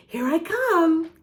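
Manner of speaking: high, singsong playful announce; playful tease. Acted. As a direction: tease the kids as you come closer. Teasing approach toward the camera.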